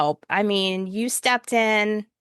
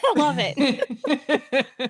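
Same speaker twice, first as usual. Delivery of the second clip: laughing; 6 semitones higher, much slower than usual. Real, not acted. Laughing with cohost.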